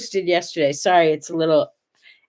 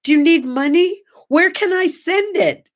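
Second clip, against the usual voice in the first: sweet worried grandma; 8 semitones higher than usual. Acted. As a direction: play the gullible grandma, all sugar. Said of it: "And I immediately said".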